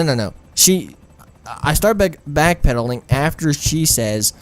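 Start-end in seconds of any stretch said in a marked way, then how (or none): none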